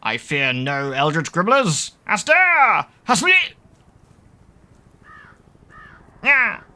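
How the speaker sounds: high, loud cartoon skeleton cry; funny skeletal horse. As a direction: yell it like a silly undead horse. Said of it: "So Hastur and a funny skeletal horse".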